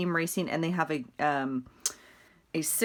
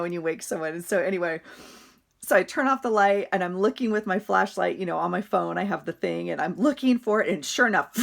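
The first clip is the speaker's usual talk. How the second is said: normal talking voice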